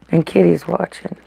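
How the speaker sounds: normal talking voice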